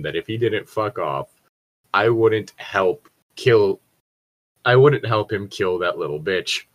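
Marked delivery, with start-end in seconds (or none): none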